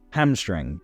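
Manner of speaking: ordinary talk, nothing marked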